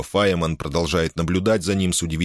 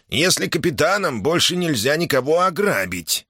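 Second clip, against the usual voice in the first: put-on high nasal old man's complaint; 10 semitones higher than usual. A narrator's reading. Old Hoxi laments the end of robbing, nasal and high. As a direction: whine it through the nose, pitched well up. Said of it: "прогнусавил старый Хокси".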